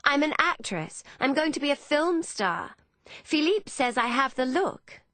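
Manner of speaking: put-on high-pitched, excitable young woman; excited boasting. Emmeline boasting to Hannah of film stardom, excited.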